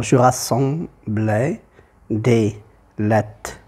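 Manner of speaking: "je rassemblais des lettres" slowly broken into syllables; syllable-by-syllable count. Shown as a demonstration. Teacher counting syllables for students, "je rassemblais des lettres" split slowly. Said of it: "si je fais le décompte syllabique".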